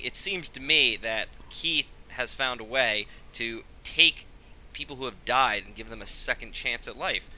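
ordinary talk

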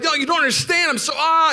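high, loud outburst breaking into "ah"; retold frustrated yelling. Husband not being understood by wife, acted frustrated yell. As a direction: yell in high-pitched frustration, running out of words. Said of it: "we're just yelling and talking very passionately".

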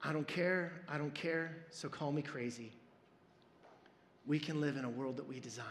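low, soft, reading song lyrics like a poem; soft lyric recitation. Shown as a demonstration. Pastor reciting a favorite song's lyrics to the church, soft and low. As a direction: recite the lyrics gently, almost to himself. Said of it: "lyrics recited again".

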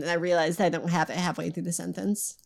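ordinary talk

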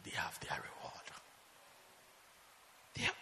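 hushed whispered line. Real, not acted. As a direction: drop to a whisper. Whispered end of verse.

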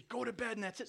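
high-pitched mock-angry order; lightly acted parental anger; mad parent. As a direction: send the kid off in a temper. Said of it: "You're mad. And then maybe you say".

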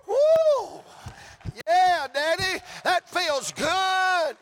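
very high shouted glee, last word stretched; exultant whoop. Acted. Revived girl to her Daddy, exultant squealing shout. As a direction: whoop it like an overjoyed child. Acts out raised girl's joy.

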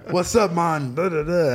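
light imitation of a Jamaican accent; lightly voiced Jamaican dad. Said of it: "with his Jamaican accent".

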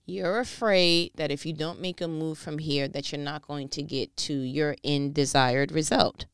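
ordinary talk, nothing marked

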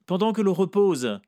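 high, loud coaxing voice; Vera speaking. A narrator's reading. Vera coaxes her young client, high and loud. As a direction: call him over, high and loud. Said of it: "a dit ma bien-aimée".

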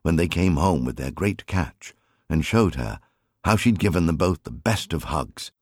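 plain reading voice

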